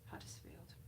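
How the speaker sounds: whispered northern attempt; attempted northern accent. Vlogger testing a forgotten accent, whispered northern. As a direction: try northern under your breath. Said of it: "that's near enough".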